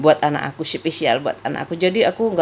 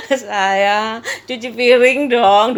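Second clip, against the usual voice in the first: sweet-talking; 4 semitones higher, 4 dB louder, much slower than usual. Retold or imitated. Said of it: "dulu loh awalnya kayak gitu".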